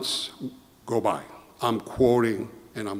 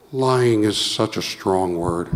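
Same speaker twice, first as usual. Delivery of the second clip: slow, drawn-out smooth reassurance with a chuckle; slow soothing dodge; 3 semitones lower, much slower than usual. Retold or imitated. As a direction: talk him down gently, lightly voiced. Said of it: "now John has this way".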